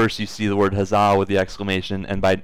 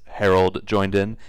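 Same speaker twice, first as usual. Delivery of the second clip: usual speaking voice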